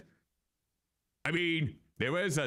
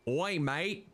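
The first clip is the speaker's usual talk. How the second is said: mock Aussie accent; 4 semitones higher than usual. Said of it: "You thought it was an Aussie accent?".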